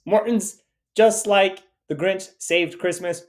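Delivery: high, slow commercial announcer; mock ad voice. Cohost opening the episode like an ad. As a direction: sell it like a cheesy TV spot.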